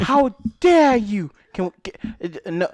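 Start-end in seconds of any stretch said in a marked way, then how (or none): 0.0-1.3 mock outrage. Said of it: "mock outrage repeated".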